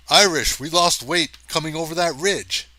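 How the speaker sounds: alarmed call. Acted. Hathaway calls out in alarm.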